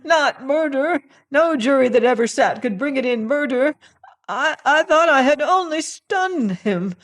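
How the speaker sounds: very high, loud frantic pleading; panicked, shrill denial of murder; cowering desperate plea. Acted. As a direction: plead in terror like a beaten hound. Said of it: "cowering like a beaten hound".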